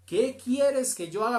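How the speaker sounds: urgent loud plea. A narrator's reading. Saul on the road begging the Lord, loud plea. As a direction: cry it out the second time, begging. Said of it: "Él, temblando y temeroso".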